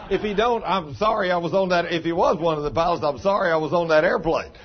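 half-laughing through the joke. Real, not acted. Joking about the clueless pilot.

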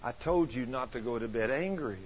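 soft, low inner voice; little voice. Retold or imitated. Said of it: "I heard this little voice say".

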